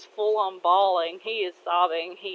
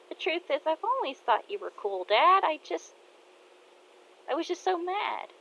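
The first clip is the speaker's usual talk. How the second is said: tearful daughter; 10 semitones higher than usual. Acted. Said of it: "Kaede starts crying and says".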